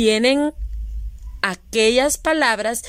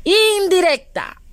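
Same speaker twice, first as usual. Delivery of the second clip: answer revealed; 8 semitones higher than usual. Shown as a demonstration. Teacher answering her own quiz, excited. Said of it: "¿Qué será?".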